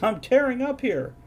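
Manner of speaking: high, strained cry of labour; woman giving childbirth. Retold or imitated. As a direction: wail it like a mother mid-delivery. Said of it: "sounds like a woman giving childbirth".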